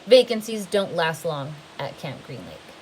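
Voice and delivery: ordinary delivery, nothing marked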